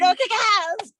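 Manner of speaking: high, gleeful holler; rousing cheer. Real, not acted. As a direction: cheer them on at full voice. Repeats the cheer after laughter.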